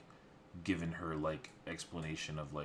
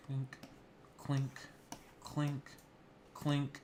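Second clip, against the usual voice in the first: armor clink sound effect; 9 semitones higher, much slower than usual. Retold or imitated.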